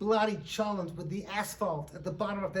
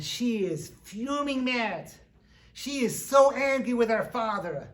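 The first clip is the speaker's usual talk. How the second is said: raised, louder storytelling with stretched stress; emphatic fury; 4 semitones higher, 6 dB louder than usual. A narrator's reading. Telling of a girl mad at her father, heated. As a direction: lean hard into the anger words. Narrator acts out girl's fury.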